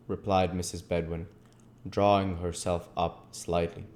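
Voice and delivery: plain reading voice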